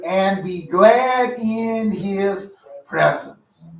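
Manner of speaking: very slow, loud, emphatic declaration; drawn-out scripture quote. Acted. Teacher quoting scripture to the congregation, slow emphatic declaration. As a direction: stretch each word out for weight.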